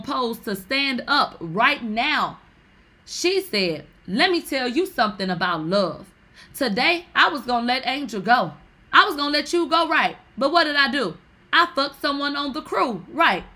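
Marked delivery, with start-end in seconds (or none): 4.1-13.4 loud, higher proclamation; hammed-up public confession, high and loud; acted-out speech. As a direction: declare it to the whole room, loudly. Acts out a speech on stage.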